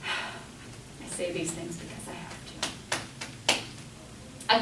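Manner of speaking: teacher admitting she must say this, whispered on a sigh; sighed aside. Real, not acted. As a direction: let the words out on a tired sigh. Sighing aside to the class.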